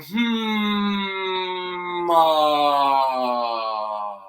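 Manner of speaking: hum, then open to an ah. Shown as a demonstration. Coach leading the class in the hum-ah warm-up. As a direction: sigh out from hum to ah. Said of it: "breathe out going".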